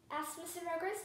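ordinary delivery

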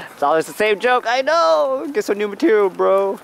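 high, loud mock heckler; mock heckle.

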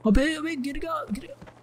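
sudden burst of glee as he drops; excited shout. Real, not acted. Gamer seeing enemy fall, high excited yelps. Enemy falls during gunfight.

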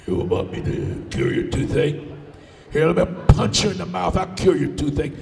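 low giant's rumble; menacing giant. Acted. Giant looks down, threatens villager.

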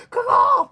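high bird screech, loud; shrieking caw. Retold or imitated. Reader imitates a swooping bird with a loud caw. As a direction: squawk like a pterodactyl.

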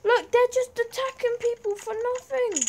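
high indignant complaint; outraged. Real, not acted. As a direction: protest the unfairness. Outraged at guards.

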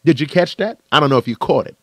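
ordinary talk

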